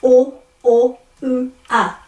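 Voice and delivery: o, o, u, a as separate pure vowels; vowel drill with gaps; vowels one by one. Shown as a demonstration. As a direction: sound out o, o, u, a evenly. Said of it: "but this is".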